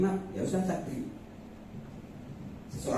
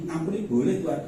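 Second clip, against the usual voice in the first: usual speaking voice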